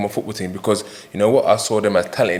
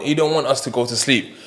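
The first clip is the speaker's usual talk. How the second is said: ordinary talk, nothing marked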